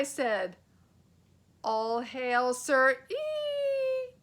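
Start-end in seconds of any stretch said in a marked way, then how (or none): 1.6-4.2 very slow, stretched hail turning to a squeak; boy spotting danger mid-hail; eek of alarm. A narrator's reading. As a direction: let the eek slip out.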